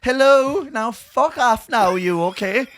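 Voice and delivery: a mum going posh when annoyed; slow exaggerated posh politeness. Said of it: "she goes posher".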